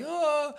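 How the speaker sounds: high, drawn-out mock wail of alarm; panicked cry. Middle schooler facing giant football players, mock panicked cry. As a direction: squeal in comic panic, held long. Young boy panics as team turns.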